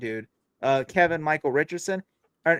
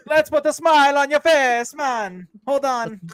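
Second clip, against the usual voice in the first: put-on slow, high Jamaican accent; drawn-out Jamaican villain parody; 9 semitones higher, 3 dB louder, slower than usual. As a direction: menace playfully in a mock Jamaican accent. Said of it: "like, [name] became the Joker".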